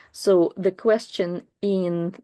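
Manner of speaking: ordinary delivery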